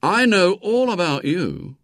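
put-on slow, high-pitched boyish menace; schoolboy darkly threatening a spy; dark and knowing. A narrator's reading. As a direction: draw it out like an ominous accusation. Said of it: "said William darkly".

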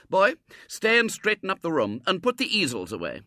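loud, high schoolmistress order; ordering mistress. A narrator's reading. Mistress telling a boy to tidy up, loud command. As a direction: give a boy brisk orders from above.

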